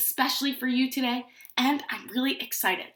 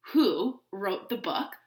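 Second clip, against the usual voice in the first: usual speaking voice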